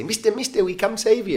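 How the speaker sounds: put-on high, chirpy children calling; hallucinated children paddling up and calling out; kids' voices. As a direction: pitch it up like little kids on a canoe. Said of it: "They say".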